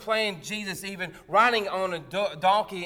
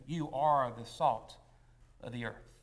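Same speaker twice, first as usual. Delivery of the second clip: slow solemn quote; 6 semitones lower, 3 dB quieter than usual. Retold or imitated. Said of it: "begins the sermon with".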